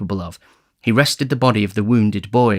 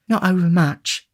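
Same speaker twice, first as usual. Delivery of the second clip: high feminine character voice; high-pitched doting young woman; demure sweetheart; 9 semitones higher than usual. A narrator's reading. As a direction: answer coyly, pitched high. Charlotte replies to Noah.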